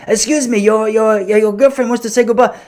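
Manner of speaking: put-on high, odd French-via-India accent; French-Indian accent. To the tourist at the airport, high mangled French-Indian accent. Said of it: "That's like a, a French guy who's lived in India".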